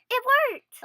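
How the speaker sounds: high, loud triumphant cry; excited cheer. Acted. Freed girl cheers the virus worked, excited. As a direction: celebrate the switch back. Kids escape, celebrating.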